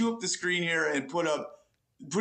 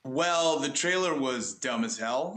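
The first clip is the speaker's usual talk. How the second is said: ordinary talk, nothing marked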